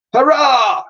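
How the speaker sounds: high, loud joyful cheer; cheering crew. Acted. Crew cheering mammoth aboard, joyful cheer. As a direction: cheer like a whole deck. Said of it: "cried all on board".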